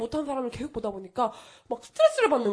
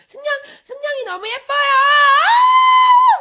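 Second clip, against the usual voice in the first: high-pitched fangirl squeal into a scream; mock fan gushing; 13 dB louder, much slower than usual. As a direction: shriek like an over-the-top adoring commenter. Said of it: "그러면서 댓글 달고요". (극성팬 흉내 내며 꺅 비명)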